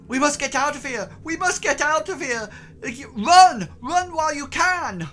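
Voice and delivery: high, loud frantic shouting; half-mad. Acted. Game master as half-mad man warning an adventurer, frantic high shout. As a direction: cry out a desperate warning like a madman. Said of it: "looks as if he's half mad".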